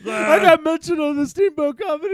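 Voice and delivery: high, loud mock-thrilled voice; imagined listener. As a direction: crow about it like a starstruck fan. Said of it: "He's listening too, going".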